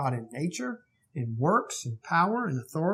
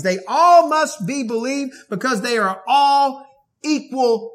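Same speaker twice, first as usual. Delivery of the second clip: raised, loud, emphatic pulpit voice; forceful preaching; 8 semitones higher, 8 dB louder than usual. Acted. Preacher thundering to his congregation about worshiping Jesus, loud and emphatic. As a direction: drive each claim home to the hearers.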